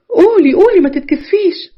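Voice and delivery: high, drawn-out encouragement; slow, lilting nudge to speak up; coaxing. A narrator's reading. Nargis urging the shy bride to answer, coaxing.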